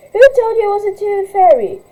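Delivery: high, loud angry demand; indignant teen. Acted. Teen demanding why a kid is in her bedroom, high and loud. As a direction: confront the intruder.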